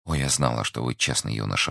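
ordinary delivery, nothing marked

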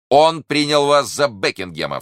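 high, loud cry of astonishment; exclaiming. A narrator's reading. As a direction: exclaim it loudly, pitched well up. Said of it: "вскричал Д'Артаньян". (громкое, высокое восклицание)

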